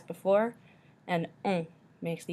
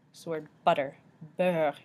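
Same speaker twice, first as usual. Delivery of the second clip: ordinary delivery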